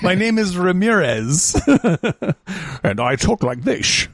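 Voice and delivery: slow, laughing mock Scottish accent as Connery; Sean Connery impression. Friend mocks Connery playing Ramirez in a slow Scottish accent. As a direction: ham up a Scottish Connery drawl, slowly. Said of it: "sounds like Sean Connery".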